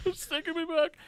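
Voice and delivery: very high and loud, still giggling; laughing. Real, not acted. Friend recalling a childhood film, laughing hard. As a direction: squeal it out mid-laugh.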